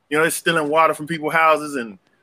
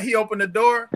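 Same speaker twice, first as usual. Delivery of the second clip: ordinary talk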